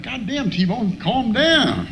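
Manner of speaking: high-pitched retold self-scolding; exasperated inner voice. Voice in the back of his head, exasperated self-scolding.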